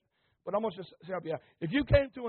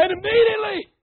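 high, loud ringing cry; excited exclamation; 14 semitones higher, 13 dB louder than usual. Real, not acted. Preacher to church, exclaiming the miracle. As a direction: burst out with it.

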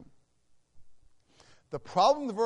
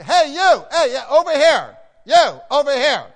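loud, high call across a crowd; yelling to get attention; 8 semitones higher, 14 dB louder than usual. Retold or imitated. Preacher calling teenagers at the theme park, loud high shout. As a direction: shout to catch the teens' ears. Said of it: "I had to yell".

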